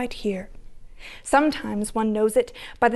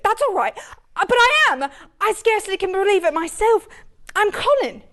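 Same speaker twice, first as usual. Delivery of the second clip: put-on high, eager boyish voice; a boy bursting to tell his father; excited boy; 8 semitones higher than usual. To his father, a boy eagerly insists it is him, voiced high.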